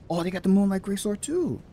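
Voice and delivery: high, thrilled exclamation; excited discovery. Real, not acted. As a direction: voice leaping up with delight. Thrilled to find a weapon.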